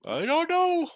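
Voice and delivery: high-pitched rising wail; comically high, helpless cry; mock-anguished doubt. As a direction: squeal your uncertainty, voice sliding way up. Throws up hands over Angels.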